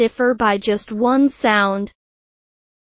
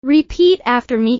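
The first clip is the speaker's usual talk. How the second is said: ordinary delivery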